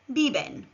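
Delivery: "biben" with b sounds, falling; model Spanish verb. Shown as a demonstration. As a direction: say "biben" clearly for learners. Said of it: "Repeat after me".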